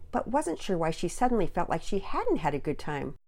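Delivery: ordinary delivery, nothing marked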